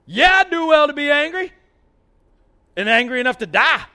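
loud, high, defiant yell; defiant anger. Acted. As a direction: throw it back at God. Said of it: "And Jonah said".

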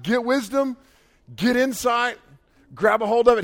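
high, forceful urging; emphatic cheering-on. Acted. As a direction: drive each command home, like cheering someone on. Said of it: "like, aggressively".

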